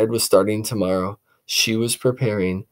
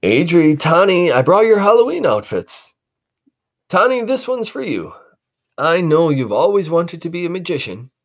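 high, jolly father; proud dad with gifts; dad surprising his kids; cheerful dad; 8 semitones higher, 5 dB louder than usual. Acted. Dad brings outfits.

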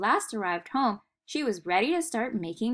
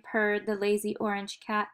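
ordinary delivery

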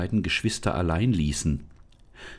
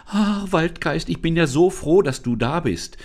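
relieved; 10 semitones higher, 5 dB louder than usual. Acted. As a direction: let the relief pour out. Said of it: "sagte sie erleichtert".

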